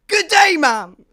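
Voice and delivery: booming posh-gentleman greeting; overly grand greeting. Retold or imitated. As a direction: sweep in like a gentleman. Posh greeting at hotel desk.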